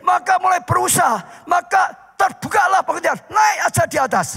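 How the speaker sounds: high, loud fervent preaching; preaching at full force. Acted. Pastor preaching to his congregation, fervent and loud. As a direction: drive the story home to the congregation.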